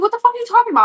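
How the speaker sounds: very high-pitched, incredulous demand; exasperated outburst. Retold or imitated. Mom to son who says he's unwelcome, exasperated. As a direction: let disbelief send the voice way up.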